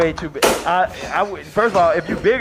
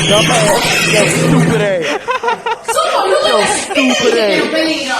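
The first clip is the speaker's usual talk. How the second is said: loud laughing yell; laughing shout; 10 semitones higher, 7 dB louder, much slower than usual. Real, not acted. Yelling at video, laughing.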